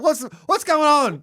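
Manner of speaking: high, loud startled confusion; confused shout. Retold or imitated. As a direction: blurt it out bewildered. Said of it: "And I'm like".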